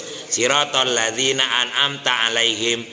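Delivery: usual speaking voice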